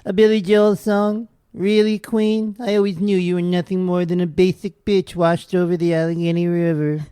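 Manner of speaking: camp, withering put-down; Truman Capote voice. Retold or imitated. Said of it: "And then Truman says".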